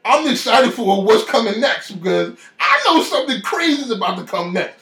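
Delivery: high, loud, thrilled rush; excited. Retold or imitated. Telling himself something big is coming, loudly excited. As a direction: burst with excitement about what's coming. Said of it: "I was excited".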